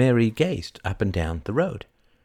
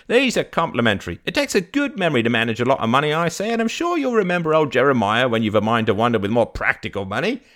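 higher, louder, rattling patter; old fruit vendor, generous; 5 semitones higher, 5 dB louder, faster than usual. Acted. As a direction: rattle it off genially as the trader.

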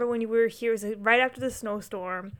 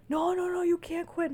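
high, pleading no no no; coworkers begging; 8 semitones higher, 3 dB quieter than usual. Retold or imitated. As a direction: beg her not to quit. Coworkers react to quitting.

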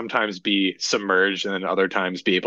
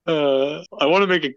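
after laughing hard; 6 semitones higher than usual. Real, not acted. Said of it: "I need to collect myself".

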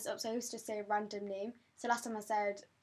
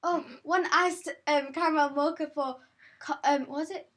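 mock barista shout; 4 semitones higher, 7 dB louder than usual. Girl imitating the barista who called her order, loud mock call. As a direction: call the order out across the counter. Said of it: "he's screaming out".

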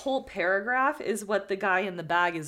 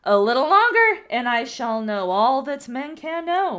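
theatrical oracle; 9 dB louder, slower than usual. Acted. Man in the sack boasting to the student, theatrical and grand. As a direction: declaim it like a wise prophet. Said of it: "this needs to be really theatrical".

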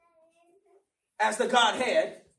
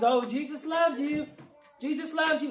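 mock sugary cliché; 4 semitones higher than usual. Mocking shallow church talk.

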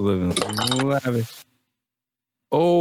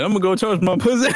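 laughing quote; 6 semitones higher, faster than usual. Retold or imitated. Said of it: "He said".

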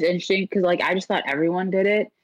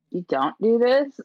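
surprised; 6 semitones higher, slower than usual. Retold or imitated. Surprised coworker doesn't know.

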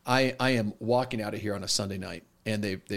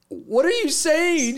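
very high, loud, slow imitation; hammed-up incredulous question; shocked disbelief; 6 dB louder, slower than usual. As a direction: stretch it out as an aghast student. Retold shocked student confronting pastor.

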